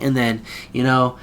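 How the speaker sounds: ordinary talk, nothing marked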